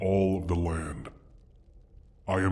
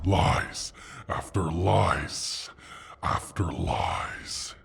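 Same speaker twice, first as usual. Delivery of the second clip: slow scornful repeat; much slower than usual. Acted. Dragon accusing the human of lying, slow scorn. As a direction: drag out each lies, slow and contemptuous.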